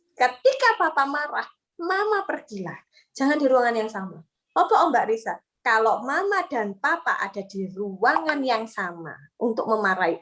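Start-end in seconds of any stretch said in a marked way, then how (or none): none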